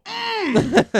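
high pleased hum after a sip; savoring hum. Retold or imitated. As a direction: savor it with a long mm. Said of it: "makes you go".

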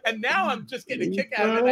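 normal talking voice

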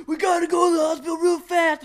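high, loud mock panic; hammed-up urgent shout; movie-style panic. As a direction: yell it like a frantic movie dad-to-be. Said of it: "it doesn't come on like the movies".

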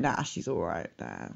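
low, quiet, shrugging it off; casual dismissive voice. Retold or imitated. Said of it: "they're like".

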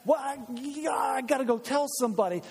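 high eager burst to go tell; excited disciple. Acted. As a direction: blurt it out, too thrilled to wait. Excited disciples voiced by preacher.

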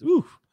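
sharp high oof at an ugly photo; pained oof. Real, not acted. Reacting to a bad picture.

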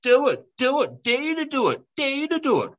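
put-on a cheeky kid daring mum; defiant kid taunt. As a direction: goad her like a bratty child. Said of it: "he's like".

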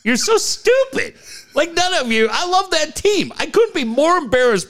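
high, scolding rant at fans; mock outrage at stupid fans; exasperated rant. Real, not acted. Ranting at fans.

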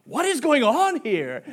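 put-on high, slow, bewildered kid voice; stretched-out, high-pitched baffled question; Max. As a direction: imitate a child's drawn-out disbelief. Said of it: "and him be like".

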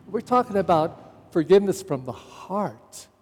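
ordinary talk, nothing marked

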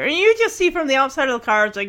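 ordinary delivery

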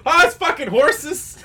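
mock caught-out confession. Acted caught-in-the-act reply.

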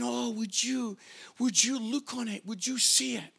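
hushed, gentle appeal; softly imploring, as God speaking to the listeners; soft pleading. Acted. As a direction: drop to a quiet, earnest plea. God's gentle appeal, after loud climax.

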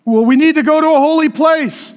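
high, loud mock worry; anxious religious people. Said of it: "People are like".

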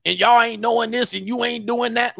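hammed-up preacher lecturing the women; mock preacher. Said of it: "And he tried to come out there".

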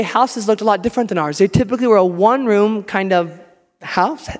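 ordinary talk, nothing marked

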